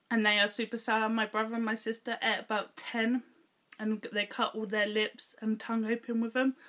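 sucking hard candy while speaking; slightly muffled by sweet; talking with candy in mouth. Real, not acted. Seen 0: Candy put in mouth at -7; lips and cheek keep working while talking.

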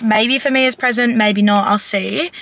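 usual speaking voice

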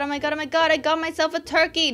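normal talking voice

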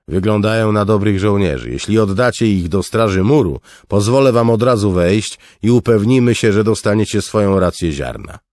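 loud, high, businesslike offer; bargaining guard. Acted. Guard to the refugees, offering entry for soldiers. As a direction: offer the deal like a recruiter.

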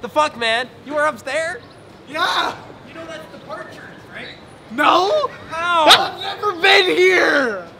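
exasperated shouting. Real, not acted. Scolding friend at airport.

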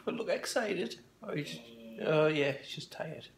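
very soft early-morning murmur; hushed. Real, not acted. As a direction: say it softly, house still asleep. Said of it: "I'm being a little bit quiet".

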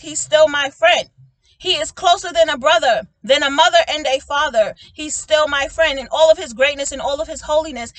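loud, ringing preacher's cadence; full-force preaching. Acted. Preacher rising to full force to the viewers about God the friend. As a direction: preach it out to the congregation.

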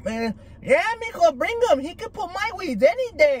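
high-pitched, eager granny imitation; excited abuelita. Abuelita butting in about the old yard worker, imitated as excited. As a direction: play an overexcited grandma, voice way up high. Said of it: "She was all excited".

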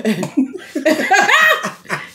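squealing laugh; high laugh. Real, not acted. Laughing at regional slang.

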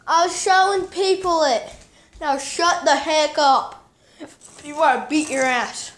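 real, heated shouting at someone; angry yelling. Real, not acted. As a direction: yell back across the house. Snapping back at someone off camera.

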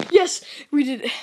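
loud, high victory shout; excited yell of having made it; triumphant. Real, not acted. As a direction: cheer with sudden relief. Survives the night in game.

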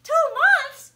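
very high, very loud disbelief; can't believe such a long sleep; astonished boy shouting; shocked. Acted. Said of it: "said Herman".

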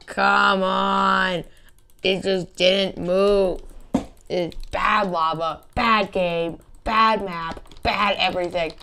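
loud frustrated outburst; frustrated yelling. Real, not acted. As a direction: rage at the game. Stuck in the level.

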